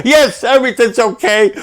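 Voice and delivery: high, weepy denial; tearful daughter. Retold or imitated. Daughter on the phone, crying, high-pitched and tearful. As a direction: brush it off through tears. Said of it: "called me crying".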